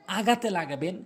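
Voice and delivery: normal talking voice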